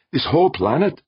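high, incredulous question; marveling. A narrator's reading. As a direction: lift the pitch in amazement.

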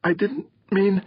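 put-on high, trembling girl's voice; a slapped daughter's quavering protest. A narrator's reading. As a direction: let the voice shake like a stunned girl. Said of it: "her voice quavering".

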